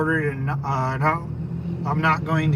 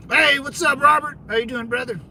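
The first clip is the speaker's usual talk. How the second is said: a bright, raised hello to a viewer; upbeat shout-out, louder and higher; cheery greeting; 7 semitones higher, 3 dB louder than usual. Real, not acted. Greeting viewer Robert by name.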